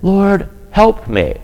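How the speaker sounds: mother pleading at Jesus' feet; pleading prayer. Retold or imitated. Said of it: "repeats her prayer".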